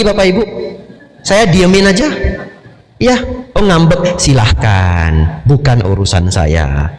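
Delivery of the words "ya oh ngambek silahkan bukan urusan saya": slow, unbothered brush-off with a drawn-out silahkan; dismissive shrug. Retold or imitated. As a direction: let them sulk, you couldn't care less. Indifferent reply to sulking partner.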